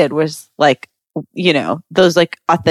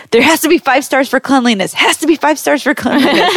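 higher-pitched, insistent imitation of mom; mock fussing, repeated as a nagging demand; fretting mom; 7 semitones higher than usual. Mom checks reviews with daughter, mock-anxious insistence. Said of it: "kind of freaking out about me".

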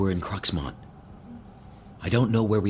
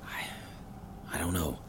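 hushed, barely voiced; whispering; 3 semitones lower, 10 dB quieter, much slower than usual. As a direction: keep it down, scared. Locked in a strange room.

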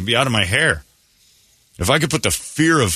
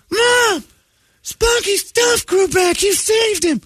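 put-on very high, drawn-out squeal of a thrilled child; excited little kid; slower than usual. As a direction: squeal slowly like an overjoyed kid. Himself as a gullible child.

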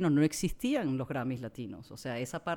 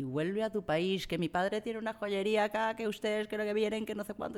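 mocking drunk bully; 6 semitones higher than usual. Drunk man's slurs retold.

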